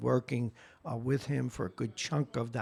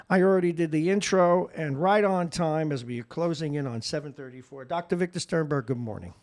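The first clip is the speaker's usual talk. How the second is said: ordinary talk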